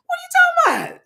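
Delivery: very high, squeaky and loud. Retold or imitated. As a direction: squeak it out like you got caught lying. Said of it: "went high-pitched, squeaky voice".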